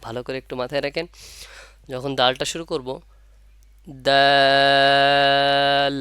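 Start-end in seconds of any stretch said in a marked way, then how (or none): 4.1-6.0 "দাল" loud, its vowel held very long on a level pitch before the l; slow-motion দাল. Shown as a demonstration. Teacher shows tongue timing, "দাল" drawn out loudly in slow motion. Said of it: "যেভাবে slow motion হয়".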